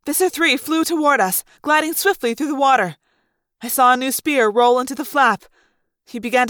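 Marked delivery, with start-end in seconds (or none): none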